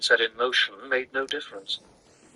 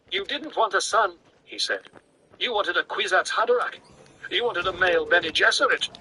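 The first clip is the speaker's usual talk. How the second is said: bitter accusation; 7 semitones higher than usual. A narrator's reading. Paul accuses his mother, bitter and rising. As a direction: throw it back at her bitterly. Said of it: "She recoiled from his bitterness".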